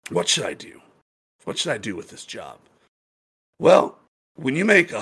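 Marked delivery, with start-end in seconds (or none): 0.1-2.6 low, soft thinking aloud; pondering. Acted. Bartender weighing the job for a week, quiet pondering. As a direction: mull it over to yourself, hushed. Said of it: "then I pondered for a week".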